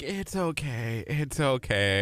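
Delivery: soft, slow, low imitated consoling; gentle reassurance. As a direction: imitate her letting it go, slowly and quietly. Retold woman consoling after awkward slip.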